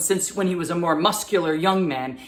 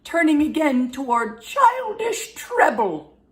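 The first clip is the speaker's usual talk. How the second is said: put-on high, piping old man's voice; childish treble; 10 semitones higher, 4 dB louder than usual. Shown as a demonstration. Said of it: "his voice got higher into his treble area".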